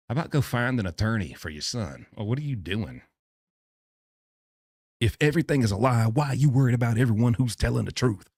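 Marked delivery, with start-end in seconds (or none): 5.0-8.3 louder, fast indignant question; louder challenge. Real, not acted. Host challenging the father, loud and fast. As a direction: push the question hard.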